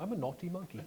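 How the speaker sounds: playful mock English accent. Shown as a demonstration. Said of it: "Sounds better with an English accent".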